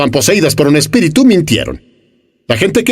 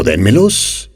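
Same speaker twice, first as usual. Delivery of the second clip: voice of Jesus; 7 semitones lower, much slower than usual. Retold or imitated. Jesus asking for the loaves, low and calm. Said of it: "Él dijo".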